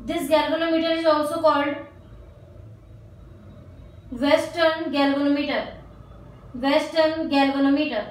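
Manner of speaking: very slow, word by word, so students can write; slow dictation. Real, not acted. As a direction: dictate each phrase slowly and clearly. Teacher dictates notes to write. (धीरे-धीरे लिखवाना)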